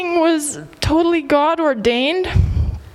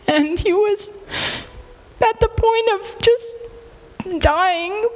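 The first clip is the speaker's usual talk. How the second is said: holding back tears; 5 semitones higher, slower than usual. Real, not acted. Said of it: "I'm sorry for getting emotional".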